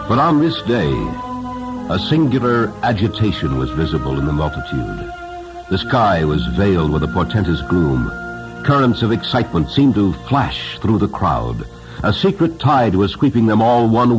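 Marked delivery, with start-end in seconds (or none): none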